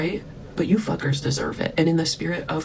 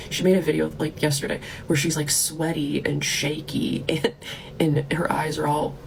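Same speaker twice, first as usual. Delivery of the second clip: usual speaking voice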